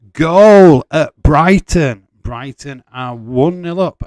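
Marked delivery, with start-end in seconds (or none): 0.1-2.1 loud, drawn-out goal call; announcing a goal to the stream, excited; goal shout. Real, not acted. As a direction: stretch out the goal news. Goal alert.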